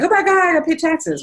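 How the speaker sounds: high, mock-panicked squeal; panicked taxpayer. Mimicking how most people react to taxes, mock panic. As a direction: play the shocked taxpayer who forgot taxes exist. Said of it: "Most of us are reacting to it like".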